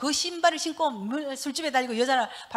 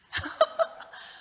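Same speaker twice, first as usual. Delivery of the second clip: laugh; 4 dB louder than usual. Real, not acted. Laughing mid testimony.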